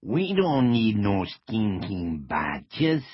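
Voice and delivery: slow, hammed-up Mexican accent. A narrator's reading. Agent mocks Cross's demand, Mexican accent. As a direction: drag it out in a mock Mexican accent. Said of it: "in his best Mexican accent".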